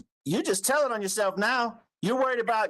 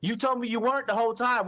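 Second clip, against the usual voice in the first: ordinary delivery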